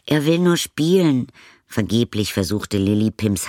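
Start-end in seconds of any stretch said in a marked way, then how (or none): 0.1-1.3 high, loud pleading; girl pleads with the caretaker, high and loud. Acted. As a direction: beg him to leave the dog alone. Girl pleads for her dog.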